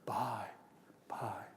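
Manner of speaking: deep, soft, breathy phone farewell; sultry low telephone goodbye; low sexy bye. Shown as a demonstration. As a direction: drop the voice low and whisper it. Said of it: "women sometimes on the telephone go".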